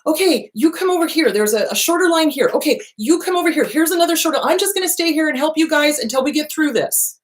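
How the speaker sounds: high, bright mock traffic director; imitating woman directing traffic. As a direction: play the little older woman waving people to shorter lines. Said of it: "She was like".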